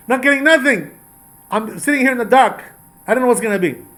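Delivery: high, loud anguished plea; frightened king, high and loud, begging for answers; desperate plea. Acted. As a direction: cry out in helpless fear. Saul pleads, frightened before war.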